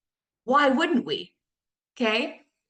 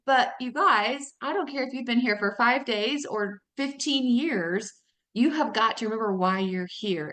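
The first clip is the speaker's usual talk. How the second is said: usual speaking voice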